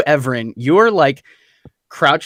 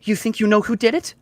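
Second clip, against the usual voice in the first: higher, halting in-character question; tentative question; 7 semitones higher, faster than usual. Acted. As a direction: ask with dawning suspicion, not yet angry. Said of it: "she gets all mad".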